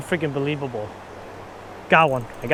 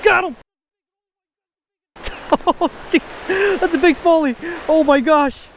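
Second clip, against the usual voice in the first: excited shouting; 10 semitones higher than usual. Real, not acted. Landing a big smallmouth bass.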